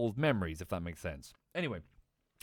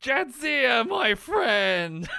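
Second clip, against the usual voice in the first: very loud, high, jubilant hail; hammed-up warrior's booming cheer; hearty Klingon greeting; 11 semitones higher, 8 dB louder, much slower than usual. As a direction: bellow a delighted welcome to a comrade. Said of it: "he just looks at her and goes".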